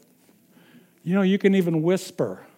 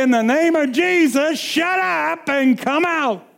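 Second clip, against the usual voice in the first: shouted rebuke; 8 semitones higher than usual. Shown as a demonstration. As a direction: yell it at the demon. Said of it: "you don't even have to yell".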